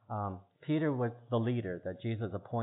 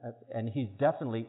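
usual speaking voice

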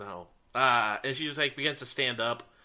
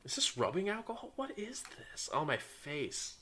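startled disgust; 9 semitones higher, 6 dB quieter than usual. Acted. GM voices woken, doused NPC.

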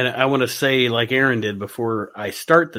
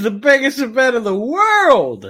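put-on high, excited little kid bragging; loud childish boast, stretched out at the end; seven-year-old; 11 semitones higher, 5 dB louder than usual. Friend mocks a wrestler's childish line, seven-year-old voice. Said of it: "sounds like something a seven-year-old would say".

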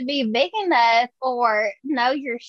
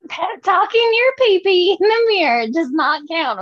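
repeat louder; 6 semitones higher, 4 dB louder than usual. Real, not acted. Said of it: "say it again".

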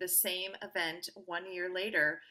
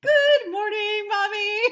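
high, slow, sugary child voice, imitating; loud, cutesy sing-song greeting; hammed-up little girl saying good morning; sweet morning greeting; 13 semitones higher, 12 dB louder, slower than usual. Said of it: "and I'd say".

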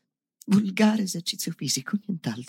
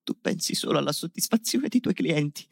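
ordinary delivery

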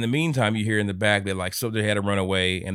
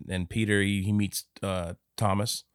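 ordinary delivery

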